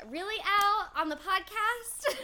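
high, loud, incredulous; mock outrage. Real, not acted. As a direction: stretch it out in disbelief.

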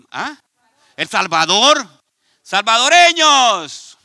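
normal talking voice

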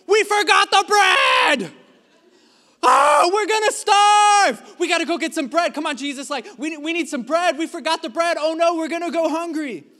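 panicked disciples yelling about forgotten bread, hammed-up; mock panic. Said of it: "they start to, like, freak out".

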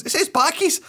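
put-on high, matter-of-fact little girl; innocent child. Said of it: "She goes".

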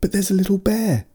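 put-on high-pitched girl's protest; small child pleading her case. A narrator's reading. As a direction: object in a high little girl's voice. Child protests to yelling father.

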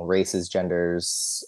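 ordinary talk

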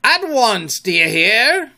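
high, loud captain's command; crying out. A narrator's reading. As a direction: shout it imperiously. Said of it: "cried Peter".

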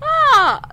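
a loud high bashful "ah"; flustered squeal. Real, not acted. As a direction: squeal it out, embarrassed and delighted. Flustered after date mention.

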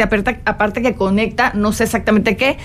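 ordinary talk, nothing marked

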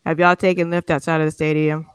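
ordinary talk

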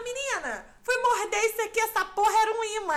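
exasperated mom. Retold or imitated. Mom explaining the magnet.

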